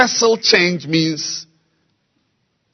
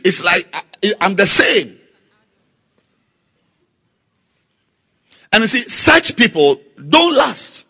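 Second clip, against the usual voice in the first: rising, louder sermon cadence; fiery preaching; 6 semitones higher, 6 dB louder than usual. Acted. Preacher rebuking Christians who won't change, heated. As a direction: build the call, line by line.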